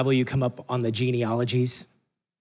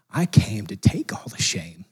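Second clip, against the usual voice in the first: whispered Jesus; 6 semitones lower than usual. Retold or imitated. Preacher voicing Jesus to his family, hushed whisper. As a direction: breathe it out as a hushed promise. Jesus voiced answering shame.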